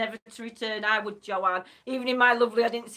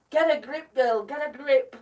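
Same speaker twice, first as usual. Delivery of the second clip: usual speaking voice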